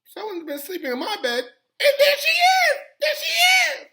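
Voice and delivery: very high squeal rising to a shriek; hammed-up tiny shrieking bear; wee little Baby Bear voice. A narrator's reading. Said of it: "cried in his wee little voice".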